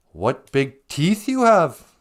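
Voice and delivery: hammed-up slow amazement; amazed Little Red. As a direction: draw it out like a storybook girl. Voices Little Red before climax.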